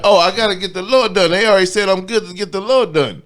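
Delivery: high, loud trainer excuse; mock trainer voice. Imitating the trainer who leaves him, high and loud. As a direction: squeak it out like the guilty trainer.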